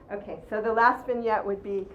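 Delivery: ordinary talk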